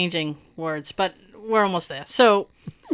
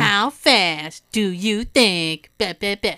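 extra slow mocking. Judge mocking the hard-of-hearing defendant, extra slow. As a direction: drag each word out slowly, condescending. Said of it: "he does it extra slow, like he's being an asshole".

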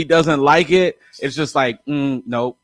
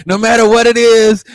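loud, higher-pitched mock insistence; emphatic retold plea; 7 semitones higher, 11 dB louder than usual. Host imitating guest's demand for honest feedback, loudly insistent. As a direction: belt it out, imitating someone demanding an opinion.

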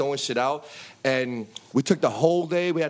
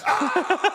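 very high Macaulay Culkin-style scream; comic panicked shriek; imitated scream of two helpless men; mock scream.

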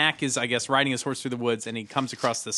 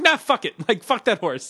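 mock dismissive rider; 7 semitones higher than usual. Host voicing the careless Cossack, mock brush-off. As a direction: toss the horse away like it's nothing. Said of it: "but it's like".

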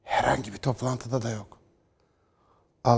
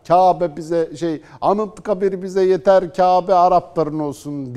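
mock pompous nationalist; 5 semitones higher, 6 dB louder, slower than usual. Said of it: "diye tezgah açanlar".

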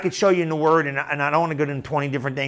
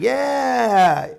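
pumped-up yeah; 5 semitones higher than usual. Retold or imitated. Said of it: "You're like".

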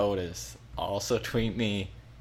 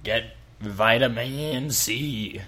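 slow pirate voice; much slower than usual. Pretend. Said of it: "as I try to do that voice".

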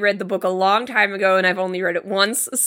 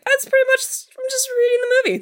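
surprised; faster than usual. Retold or imitated. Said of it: "I was like".

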